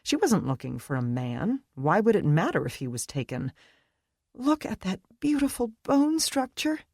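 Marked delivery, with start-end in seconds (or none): 4.3-6.8 lightly voiced girlfriend, higher and admiring; a hint of Brittany, hushed and dreamy; Brittany's murmur. A narrator's reading. Friend admiring the stranger, murmured higher. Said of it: "Brittany murmured".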